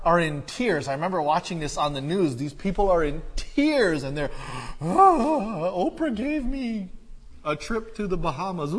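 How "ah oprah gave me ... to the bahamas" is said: high, loud, sobbing fan voice, hammed up; mock weeping fan. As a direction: blubber it out like an overjoyed audience member. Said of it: "they're just weeping and they're crying".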